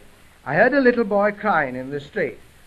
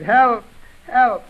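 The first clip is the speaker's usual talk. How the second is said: put-on small boy's modest cry for help, a little raised; higher, louder call that falls short of yelling; half-hearted shout; 4 semitones higher, 6 dB louder than usual. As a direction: call out for help, but not very loud. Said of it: "let me hear you shout now".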